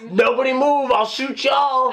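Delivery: high-pitched silly gunman impression; John Cusack as Elmer Fudd. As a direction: play a comic cartoon stick-up. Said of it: "John Cusack as Elmer Fudd".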